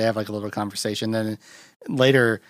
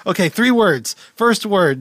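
higher, louder game call; charades guesser; 9 semitones higher, 6 dB louder than usual. Retold or imitated. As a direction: call out charades clues eagerly. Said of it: "charades or whatever".

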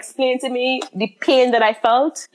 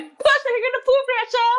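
much higher, strained urging; strained self-talk; 14 semitones higher than usual. Retold or imitated. Mother urging herself while pushing, high and strained. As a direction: push yourself on, voice up high.

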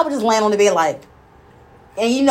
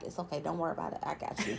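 soft whisper, almost breath; whispered reassurance; 4 semitones lower, 19 dB quieter, faster than usual. Retold or imitated. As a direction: whisper it close to her ear. Husband reassuring her in labor.

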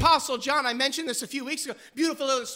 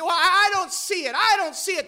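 higher, louder shrugging doubt; lightly voiced careless reader; dismissive skeptic; 6 semitones higher, 4 dB louder than usual. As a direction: brush it off lazily. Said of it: "We say".

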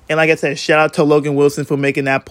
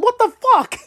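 much higher, strained cry of disbelief; retelling, he cries it out even higher; shocked cry. Retold or imitated. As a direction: let the shock break the voice upward. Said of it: "That's what I pretty much said".

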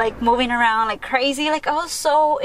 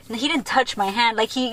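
ordinary talk, nothing marked

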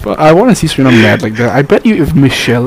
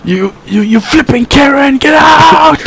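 stammering into a high, drawn-out shout; sputter, then yell a clean insult; hammed-up, high shouted fury; mock self-censored outrage; 14 semitones higher, much slower than usual. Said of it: "trying not to, you know, swear at her".

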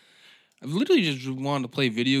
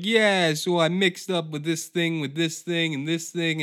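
mock-cheery bartender, pitched up and louder; chirpy bartender pushing a mixed shot; hammed-up upbeat bartender pitch; 5 semitones higher, 5 dB louder than usual. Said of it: "the bartender will be like".

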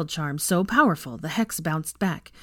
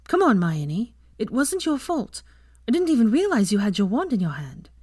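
put-on raised girl's voice, coaxing; reassuring Ginny; 5 semitones higher than usual. Ginny tells Hermione it wasn't her fault, reassuring. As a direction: talk a shaken friend round, pitched a little high.

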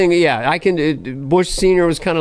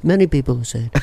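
boastful president, mumble mixed with nasal New York; self-satisfied presidential impression; Trump. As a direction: repeat yourself like a rambling president. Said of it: "It's like Trump is Brando and Regis".

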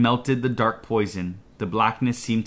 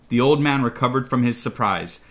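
usual speaking voice

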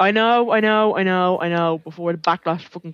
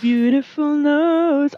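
drawn-out praise; 8 semitones higher, much slower than usual. Real, not acted. Teasing praise of friend's nose.

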